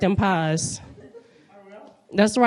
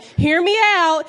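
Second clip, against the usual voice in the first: mock pitch; 7 semitones higher than usual. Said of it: "I was like".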